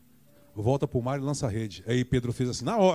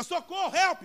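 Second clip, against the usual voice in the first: mock cry for help. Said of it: "começou a gritar".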